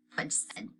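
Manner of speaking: usual speaking voice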